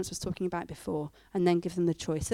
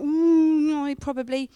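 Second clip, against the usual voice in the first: grudging admission; 4 semitones higher, 5 dB louder, slower than usual. Retold or imitated. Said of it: "And I think".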